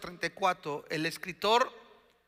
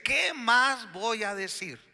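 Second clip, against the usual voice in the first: high, drawn-out rhetorical question; emphatic question; 4 semitones higher, 3 dB louder than usual. Acted. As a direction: throw the question out high and slow. Preacher voices the author's question emphatically. (pregunta enfática, aguda y alargada)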